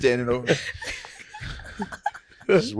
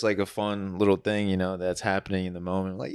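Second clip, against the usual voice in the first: ordinary delivery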